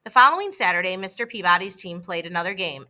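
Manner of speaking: ordinary delivery, nothing marked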